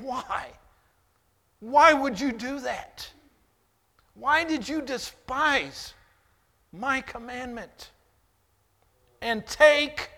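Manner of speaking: loud, high pained demands; anguished outcry. Acted. Preacher voicing God confronting David, loud and pained. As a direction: cry out why as a wounded God. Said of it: "And he's saying".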